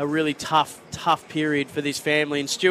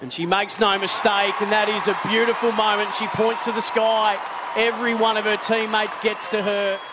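raised, thrilled goal call; live play-by-play; 4 semitones higher than usual. Acted. Live football commentary.

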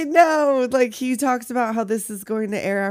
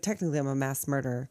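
deadpan correction; 6 semitones lower, 6 dB quieter, faster than usual. Retold or imitated. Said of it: "He's like".

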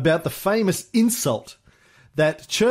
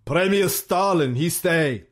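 Pavlov; 4 semitones higher than usual. Acted. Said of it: "said interpreter Pavlov".

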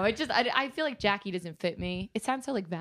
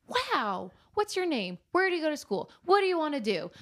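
friendly Woody; 8 semitones higher than usual. Retold or imitated. Quoting Woody's friendly questions.

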